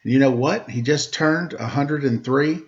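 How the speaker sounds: lively rhymed storybook reading; reading a story to children. A narrator's reading. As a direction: read the rhyming story aloud to the kids. Reads a rhymed picture book aloud.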